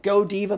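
Godiva said go-DEE-vuh; playful pronunciation. Shown as a demonstration. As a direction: open Godiva with a full GO, then DEE-vuh. Playing with brand name's pronunciations.

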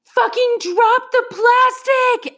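high, slow shriek at everyone; panicked shriek to drop it; frantic scream. Retold or imitated. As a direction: scream it in a panic across the house. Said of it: "frantically screamed through the house".

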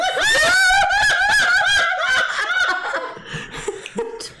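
high, loud, uncontrollable laughing fit; helpless shrieking laugh mid-reading; burst of laughter. Real, not acted.

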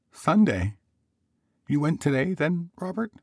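very high wifely query; questioning wife. Acted. Wife asking Robert about today, high questioning voice. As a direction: ask in a high woman's voice.

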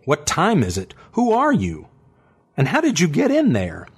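high, startled questions; alarmed woman. A narrator's reading.

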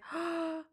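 high, sudden gasp of delight; awestruck gasp. Retold or imitated. Shopper spotting tortoises at the store, delighted gasp.

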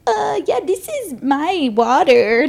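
high, slow, faux-innocent drawl; pretending the champagne is only water; sarcastic. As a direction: play dumb about the drink. Sarcastic retold reply.

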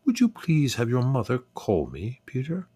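high, polite English lady's voice; calm English accent. A narrator's reading. Neighbour asking Peter a favour, calm English accent. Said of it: "spoke so calmly and with her English accent".

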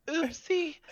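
put-on high, sheepish little-girl oopsie. Girlfriend caught out, squeaky high sheepish oopsie. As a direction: play it guilty and tiny. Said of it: "I see her just sitting there, like".

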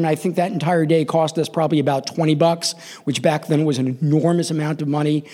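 ordinary delivery

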